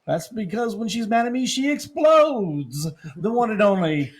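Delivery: high, loud radio-host build-up; booming morning-show hype; hype announcer intro. Acted. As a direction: sell the hosts like a ring announcer.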